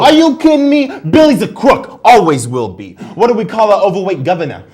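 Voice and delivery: high, loud Jersey outrage; loud hammed-up fury; indignant outburst. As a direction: blow up at the very idea. Jersey guy ranting on quiz show.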